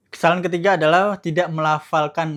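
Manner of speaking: ordinary talk, nothing marked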